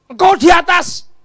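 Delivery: high, loud, slow call; sudden shouted praise; loud cry. Acted. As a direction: proclaim it upward.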